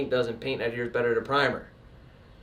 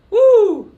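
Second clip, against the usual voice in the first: much higher, much louder party whoop; excited whoop; 12 dB louder than usual. Shown as a demonstration. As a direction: let out a loud happy cheer. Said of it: "we're having fun".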